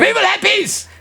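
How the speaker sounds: high, shouted mock tyrant with a laugh; mock warlord. Host teasing caller about her peace, mock tyrant shout. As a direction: bellow it like a crazed dictator. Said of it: "your version of peace is".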